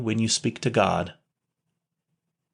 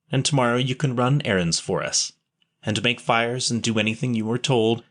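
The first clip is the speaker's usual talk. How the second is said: ordinary delivery, nothing marked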